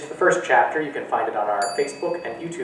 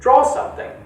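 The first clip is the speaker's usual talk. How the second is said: brisk mother; 14 semitones higher, 5 dB louder than usual. Acted. Distracted mother answers.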